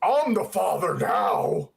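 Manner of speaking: low, slow mock villain line; cheesy villain line. Mocking Nuclear Man's cheesy line, low and slow. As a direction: ham up the cheesy movie line. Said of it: "he used that cheesy line".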